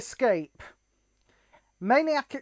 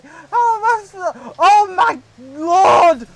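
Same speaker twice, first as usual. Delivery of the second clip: exasperated panic; 4 semitones higher, 7 dB louder than usual. Real, not acted. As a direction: wail it in disbelief. Panicking mid-race.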